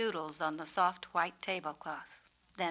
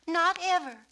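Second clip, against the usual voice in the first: high, loud insistence; fervent promise; 10 semitones higher, 9 dB louder than usual. Acted. Suki promising the peacock fervently. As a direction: promise it with all her heart.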